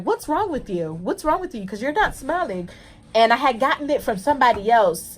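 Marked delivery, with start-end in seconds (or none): 0.0-2.7 higher, softer prying question; a light mock of a nosy coworker; imitating cashier. Cashier questioning her in the early morning, higher softer prying tone. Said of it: "she just was like".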